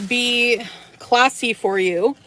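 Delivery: ordinary delivery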